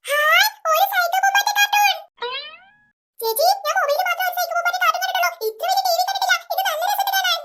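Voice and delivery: put-on a kid amazed at the big TV; wide-eyed child gushing; excited little kid.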